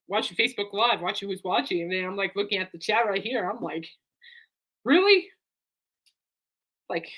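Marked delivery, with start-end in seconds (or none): 4.9-5.3 high, louder incredulous question; retold disbelief, pitched way up. Streamer reacting to pay-per-view chat, incredulous.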